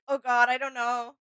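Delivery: ordinary delivery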